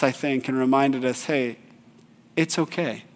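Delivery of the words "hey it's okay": much softer, calm and kind; gentle reassurance. Retold or imitated. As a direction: soothe the crying kids, quietly. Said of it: "checked us".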